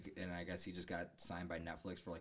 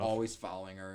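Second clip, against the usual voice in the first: ordinary delivery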